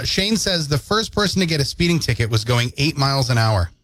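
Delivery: usual speaking voice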